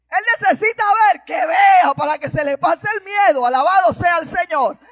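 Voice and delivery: fiery preaching. Acted. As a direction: preach it out to lift the congregation. Preaching at full force.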